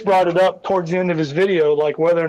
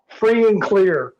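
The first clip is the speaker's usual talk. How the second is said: ordinary delivery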